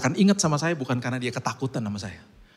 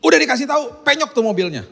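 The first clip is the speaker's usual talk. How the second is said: much higher, louder angry scold; scolding parent; 13 semitones higher, 8 dB louder than usual. Pretend. Typical parent scolding son over dented car, angry. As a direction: snap at the kid like an annoyed parent. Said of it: "akan ngomong apa sama anaknya".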